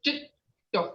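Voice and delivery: "Jit, do" with a short break between syllables; split double consonant. Shown as a demonstration. Teacher showing the tongue on the palate, split syllables. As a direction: pause after "Jit" before "do". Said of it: "you don't hear the first D".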